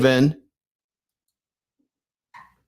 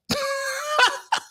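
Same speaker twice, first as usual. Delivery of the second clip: a sudden squealing laugh; high burst of laughter; 7 dB quieter than usual. Real, not acted. Host cracking up at his own line to viewers, squealing laugh.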